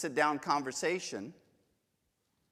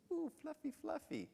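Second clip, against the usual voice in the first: cooing; 12 semitones higher, 12 dB quieter than usual. Retold or imitated. Cooing over a sheep.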